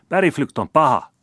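put-on a girl yelling at the gardener, high and loud; girl's shout. A narrator's reading. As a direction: shout it out like a furious little girl. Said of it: "huusi tyttö".

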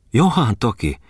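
lightly acted country hostess exclaiming; hostess's exclamation. A narrator's reading. Hostess's exclamation in voiced dialogue.

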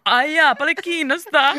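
high, loud mock-questioning. A narrator's reading. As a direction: toss it out like a doubtful question. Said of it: "sanoi Mika kysyvällä äänellä". (kysyvällä äänellä)

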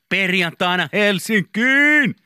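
high, loud drawn-out hurrah; excited shout. Retold or imitated. Reading out own old Facebook post, excited shout. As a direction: shout it out high, stretching the last word.

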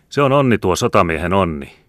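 high, loud, jovial call; cheerful shout. A narrator's reading. Soldier bursting into the farmhouse, cheerfully hollering. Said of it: "huusi hän iloisesti".

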